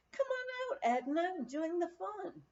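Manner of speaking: lightly voiced guest, high and coaxing. Sympathetic guest coaxing Edna to join the fun, high cheerful call. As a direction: call her out to the party, inviting. Guest calling her from living room.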